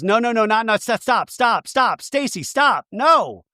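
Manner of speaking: high, repeated pleading to stop; imitated frantic protest. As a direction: act out fending someone off, pitch climbing. Acting out Ashley fending off breath.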